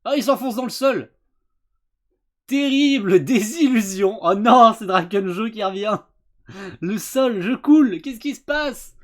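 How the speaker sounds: loud hyped commentary; excited. Real, not acted. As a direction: react big to the twist. Hyped reaction to twist.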